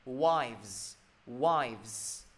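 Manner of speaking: WIVES with voiced v-z at the end; model pronunciation. Shown as a demonstration. Teacher showing the plural, WIVES with clear v-z ending. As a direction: stretch WIVES and sound every final consonant. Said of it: "từ này sẽ đọc gì?".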